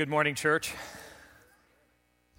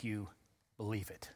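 whisper; 7 semitones lower, 14 dB quieter than usual. Real, not acted. Pastor drops to hush on key line.

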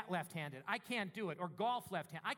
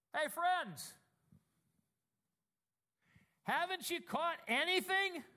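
calling across the water, higher and louder; 7 semitones higher, 4 dB louder, slower than usual. Acted. Jesus calling to the disciples from the shore, a raised call. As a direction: hail them from the shore. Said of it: "he called out to them".

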